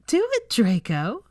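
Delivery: slow, laughing insistence; chortling. Acted. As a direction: chuckle through the demand. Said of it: "she chortled".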